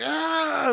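high, loud mock yelp. Imitating a preteen yelping at the old man. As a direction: squeal it like a whiny kid. Kid's exaggerated yelp retold.

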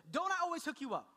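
high-pitched, exasperated appeal; worked-up parent's catchphrase; pleading dad. Retold or imitated. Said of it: "I, I say".

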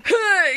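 high mock wail of hurt; gutted TikTok sound. Shown as a demonstration. Reacting to the store memory with a high, gutted sound. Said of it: "TikTok sound where you're just gutted".

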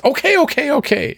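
very high, huffy giving up; a fed-up stranger backing off; exasperated NPC. Acted. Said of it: "He says".

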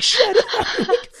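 husband mimicking wife's shut up; mock wife. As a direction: squeak it like the wife. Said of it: "She's like".

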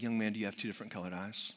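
low, soft and quick; hushed teacher. Retold or imitated. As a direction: speak privately to a small child. Teacher face to face with child.

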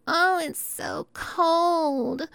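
high, slow whiny imitation of complainers; mock whiner. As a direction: mimic people moaning about the cold. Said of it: "Most people".

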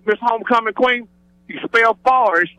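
normal talking voice

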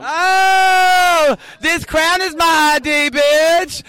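loud, high exclamation; real delighted outburst. Real, not acted. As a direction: crow it loudly at the guest's comeback. Delighted outburst at guest's comeback.

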